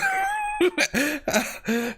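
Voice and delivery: a high burst of real laughter. Real, not acted. Laughing at funny texts.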